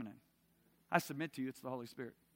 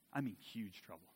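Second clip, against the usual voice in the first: ordinary talk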